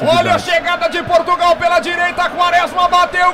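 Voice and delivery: loud, rising shout of the goal; explosive goal commentary. Real, not acted. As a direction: roar the goal call. Goal call Quaresma.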